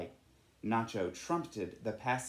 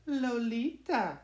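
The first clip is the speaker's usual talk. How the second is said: much higher, slow, bashful; a blushing little bird giving her name; the swallow answering Nacho, much higher and slow; shy swallow; 10 semitones higher, slower than usual. A narrator's reading. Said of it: "she said".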